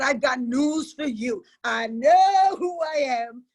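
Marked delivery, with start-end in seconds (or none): 1.6-3.4 slow, loud, ringing declaration; a defiant shout of freedom; proud declaration. Real, not acted. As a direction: proclaim it for the whole room to hear. Testimony, declaring her freedom.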